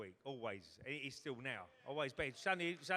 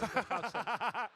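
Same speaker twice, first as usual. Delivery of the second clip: big high burst of laughter; loud laugh; 8 semitones higher, 7 dB louder than usual. Real, not acted. Comic laughing at his own pub story, loud high burst. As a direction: let a real laugh burst out.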